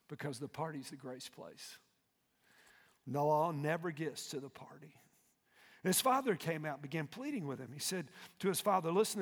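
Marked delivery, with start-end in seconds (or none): none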